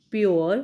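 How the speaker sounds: प्योर said on its own, clearly; word demonstration. Shown as a demonstration. Teacher models प्योर for students, clearly sounded out. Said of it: "उसको बोल के देखिए".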